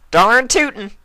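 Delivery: very high, loud country exclaim; Applejack agreeing. A narrator's reading. Applejack backing the toast, loud and high. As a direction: hoot it out gleefully.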